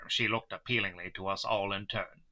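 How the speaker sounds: ordinary delivery, nothing marked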